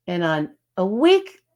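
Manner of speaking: ordinary talk, nothing marked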